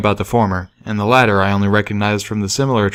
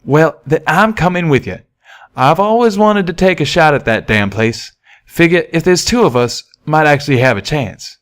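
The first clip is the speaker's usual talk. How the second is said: raised, friendly cowpony twang; Calamity's voice; 6 semitones higher than usual. Acted. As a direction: speak as the eager young pegasus. Calamity speaks.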